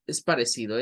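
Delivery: usual speaking voice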